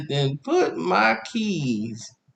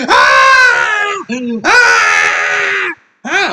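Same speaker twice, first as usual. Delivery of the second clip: high, loud mock child screams; screaming child; 12 semitones higher, 8 dB louder, much slower than usual. Boy being dragged to the car, high loud screaming. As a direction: wail like a kid being dragged.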